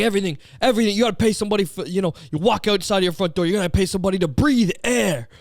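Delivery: high-pitched mock outrage; exasperated imitation, insistent to the end; imitated rant. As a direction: imitate a ranter piling up grievances. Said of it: "going on this whole goddamn thing".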